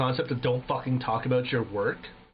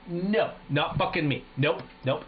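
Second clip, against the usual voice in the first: usual speaking voice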